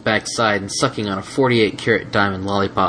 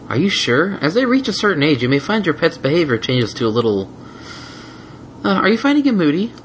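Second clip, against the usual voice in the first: flustered nurse voice; 8 semitones higher than usual. Acted. Nurse reading checklist.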